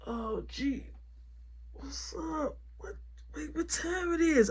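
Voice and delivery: soft groggy mumble, half awake; groggy just woke. Retold or imitated. Worker just woken by boss, softly groggy and confused. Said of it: "He get up, he like".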